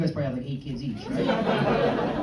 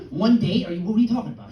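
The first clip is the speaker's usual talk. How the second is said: incredulous; 4 semitones higher, 6 dB louder than usual. Retold or imitated. Comedian's incredulous reply.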